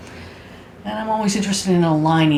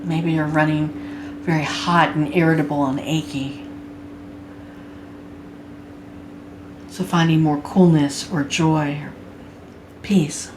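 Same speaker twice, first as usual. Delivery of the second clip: guided meditation. Acted. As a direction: guide them gently into body awareness, unhurried.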